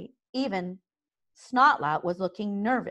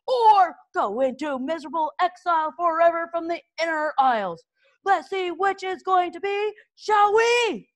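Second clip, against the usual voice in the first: much higher, loud bullying instructor voice; gruff drill master; 10 semitones higher, 4 dB louder than usual. A narrator's reading. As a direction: lord it over the trembling boys. Gobber addresses the boys.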